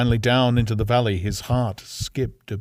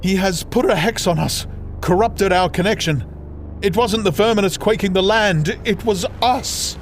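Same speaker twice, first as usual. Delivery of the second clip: urgent sister; 9 semitones higher than usual. Acted.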